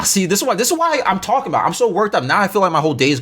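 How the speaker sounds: fast, flustered and heated, voice climbing; getting riled up while ranting; worked up. Real, not acted. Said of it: "I'm so worked up right now".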